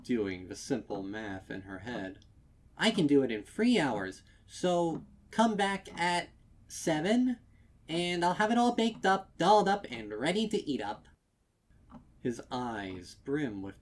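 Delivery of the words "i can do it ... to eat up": high, loud and quick; rattling off a cheerful promise; chirpy fast mayor. A narrator's reading. Mayor reassuring the customer, fast and chirpy.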